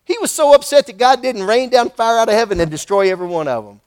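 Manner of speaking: normal talking voice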